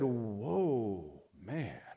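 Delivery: low, slow, drawn-out awe; awestruck. Acted. Adam on waking up and seeing her, awestruck. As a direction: let it sink down in wonder. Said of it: "I think he said".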